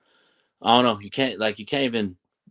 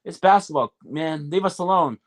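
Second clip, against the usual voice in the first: higher and slow, fed-up protest; exasperated player; 6 semitones higher, slower than usual. Retold or imitated. Player telling the ref to back off, high fed-up protest. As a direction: push back at the ref like you're sick of it. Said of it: "it's like".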